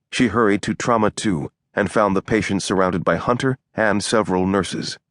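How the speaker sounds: ordinary delivery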